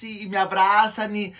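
ordinary talk, nothing marked

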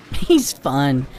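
high-pitched, knowing female friend; knowing tease. Acted. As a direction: tease with a wink in the voice. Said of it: "added with a knowing wink".

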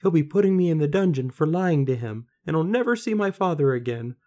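high, loud tearful wail, acted; crying girl. A narrator's reading. Daughter fearing the dungeon for lying, acted crying. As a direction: build to a despairing lament for her father. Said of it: "Now stop that crying".

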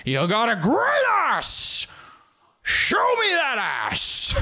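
slow, high, hammed-up bellow; Al Pacino. Podcaster role-playing as Pacino, shouting. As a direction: roar it with long stretched vowels. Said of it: "Just role-playing as Pacino".

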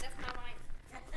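slow unvoiced whisper of the refusal. Shown as a demonstration. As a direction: whisper it out, drawn out.

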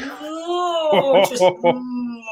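high-pitched mock wail of horror; a strangled high squeal of embarrassment; mortified no. Telling the podcast hosts about his blunder, high horrified wail. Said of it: "and you're like".

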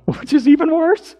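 high laughing aside. Real, not acted. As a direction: laugh through the words. Laughing at own story.

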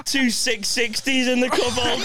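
laughing. Real, not acted. Laughing joke about the dad.

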